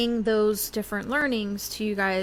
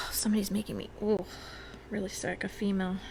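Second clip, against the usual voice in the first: whispering; 6 dB quieter, faster than usual. Real, not acted. Medium senses spirit.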